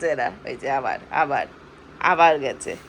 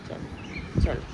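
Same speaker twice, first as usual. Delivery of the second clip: whisper; 5 dB quieter, slower than usual. Real, not acted. Whispering to a sleeping child.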